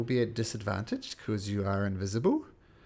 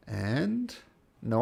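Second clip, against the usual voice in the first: ordinary talk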